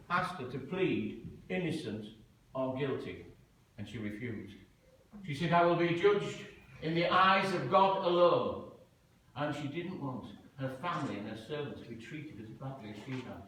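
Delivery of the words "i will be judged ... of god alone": loud, firm and defiant; a martyr's loud refusal; defiant declaration. Retold or imitated. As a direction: proclaim it to the judge with conviction. Defiant martyr before the judge.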